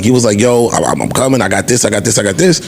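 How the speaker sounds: ordinary delivery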